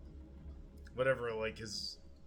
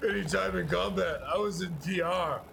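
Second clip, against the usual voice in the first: mocking JD Vance; 4 semitones higher, 8 dB louder than usual.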